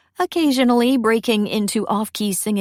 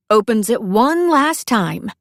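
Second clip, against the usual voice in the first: ordinary delivery, nothing marked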